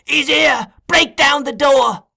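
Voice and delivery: high, loud shout; a trapped boy shouting for rescue; shouting to crowd. A narrator's reading. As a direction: yell down to the people below.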